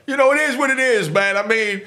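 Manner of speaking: loud, high, urgent preaching; passionate warning. Real, not acted. Warning young viewers off crime, loud and high. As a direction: raise the voice and warn them hard.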